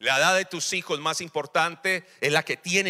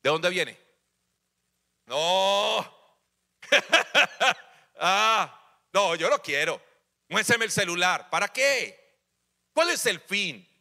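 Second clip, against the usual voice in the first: mock interrogation; slower than usual. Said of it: "como un interrogatorio".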